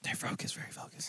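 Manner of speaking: soft hushed whisper, no voice. Real, not acted. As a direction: whisper so the team isn't disturbed. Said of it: "As we're whispering right now".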